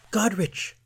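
high, loud joyful gasp of a name; sudden gasp of recognition; gasped surprise. Acted. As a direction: cry out the name in delighted surprise. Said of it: "gasped Iareth".

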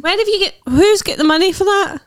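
incredulous. Real, not acted. Incredulous at pricey presents.

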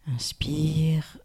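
hushed, whispery cue to breathe; breathing cue. Shown as a demonstration. Guide showing listeners how to breathe, hushed breathing cue. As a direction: let the word drift out on the breath.

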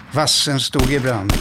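usual speaking voice

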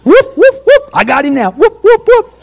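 high, loud mock siren yelps; police siren whoop. Gospel police pulling the preacher over, hammed-up siren whoops. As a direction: wail it like a siren, gleefully. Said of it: "you can get the gospel police on you".

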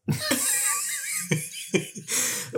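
high squealing fax tone; fax machine screech. Pretend. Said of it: "my impression of fax machine".

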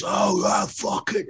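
a hoarse, high retold yell from a crowd; imitating a drunk mob's war cry; mock distant yell. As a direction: shout it like a thug far off. Retold shout from the gang.

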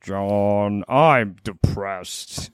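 low, drawn-out lazy moan; fat, gloomy cartoon cat; Garfield. Garfield complaining to John, deep lazy drawl. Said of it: "That's how Garfield sounds".

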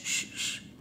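put-on soft whispered shh to hush a crying baby; breathy hushing sounds; gentle shushing to calm a child. Shown as a demonstration. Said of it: "no se les debe hacer shh".

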